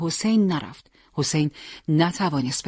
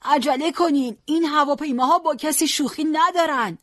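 high-pitched urgent warning cry; old man's urgent yell, pitched high; loud shout; 11 semitones higher than usual. A narrator's reading. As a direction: cry out a warning to hurry everyone along. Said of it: "پیرمرد بلند فریاد زد".

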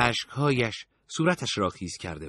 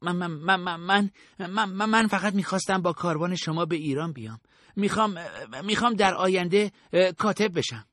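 higher, frightened stammer that rushes once it gets going; terrified slave boy stammering before his captors; 8 semitones higher than usual. A narrator's reading. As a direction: stutter out of fear, then blurt the wish. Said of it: "منمن کرد".

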